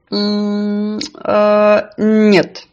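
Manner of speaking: hesitant hums before answering; drawn-out deliberating; pondering. A narrator's reading. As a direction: think it over aloud, then decline. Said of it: "сказала рыба, подумав".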